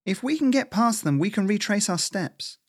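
high-pitched squirrel voice; tense, high voice working out a plan; squirrel, urgent planning. Acted.

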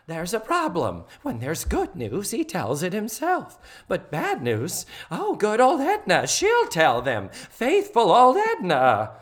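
high, melodramatic self-pitying lament; Missus Garrett. Retold or imitated. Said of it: "I won't do it in that voice".

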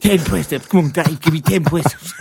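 mock fitness coach barking in a Welsh-Cockney accent. Host playing Joe Wicks ordering Dave to do push-ups, mock accent. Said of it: "part Welsh, part Cockney".

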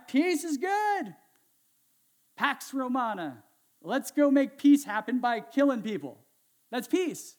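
high, drawn-out cheer turning loud and emphatic; mock enthusiasm. Preacher voicing the Roman world's peace by killing, mock-enthusiastic. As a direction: sell a brutal idea as good news.

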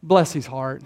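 low, soft, knowing sarcasm; the preacher's favourite sarcastic line, said low and soft; sarcastic bless his heart. Retold or imitated. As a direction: murmur it like a fake blessing that means the opposite. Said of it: "Here's my favorite one".

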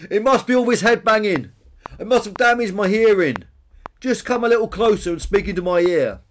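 high, loud, mock-deaf dinosaur; scheming bonehead Peter. A narrator's reading. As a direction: loudly lure him in, feigning deafness. Peter lures Billy closer.

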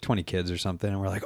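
normal talking voice

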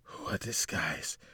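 low, slow muttered question; muttering. A narrator's reading. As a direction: mutter it under your breath. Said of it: "she muttered".

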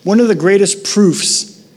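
ordinary talk, nothing marked

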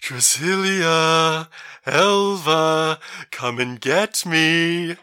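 drawn-out eerie call; loud unsteady voice. Acted. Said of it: "wavering voice ringing through the cavern".

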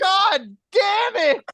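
loud, high and slow curse; cursing at cohosts, loud and slow; frustrated shout. Real, not acted. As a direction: drag out the curse in exasperation. Exasperated outburst.

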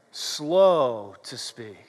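low, slow and drawn out. Shown as a demonstration. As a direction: sink the voice and stretch it. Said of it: "would you repeat this after me".

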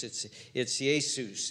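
low, slow "Jesus" as yay-SOOS; Greek pronunciation. Shown as a demonstration. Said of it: "in Greek".

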